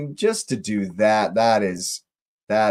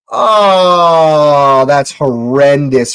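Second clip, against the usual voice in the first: loud pained cry of disbelief; shocked shout; 3 semitones higher, 10 dB louder, much slower than usual. Real, not acted. Watching the keeper gift a goal, anguished shout. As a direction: yell out in horror. Sees keeper's horrendous error.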